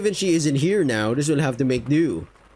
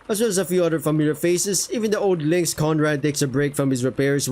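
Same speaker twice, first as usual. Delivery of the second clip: normal talking voice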